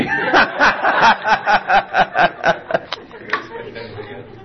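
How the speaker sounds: long high real laugh; a real, open laugh. Real, not acted. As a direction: burst out laughing at yourself. Speaker laughs after boss remark.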